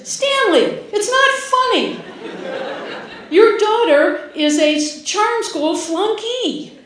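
high, loud indignant scolding; outraged mother. Retold or imitated. Mother scolding laughing husband, high and outraged.

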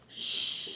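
soft, secretive shush; hushing shh. Retold or imitated. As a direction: imitate mom's quiet shush. Mom hushes the family.

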